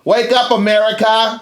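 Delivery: high, drawn-out mock shout; mock rallying cry. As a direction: shout it like waking up a nation.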